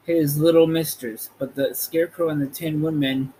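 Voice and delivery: ordinary delivery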